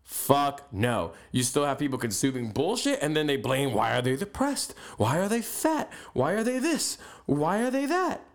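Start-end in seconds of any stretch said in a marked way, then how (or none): 3.7-8.2 each question climbing higher, rising at the end; exasperated mock questioning; mock whining questions. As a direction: parody the complainers, pitch going up and up. Mocking people who blame others.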